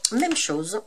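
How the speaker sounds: ordinary talk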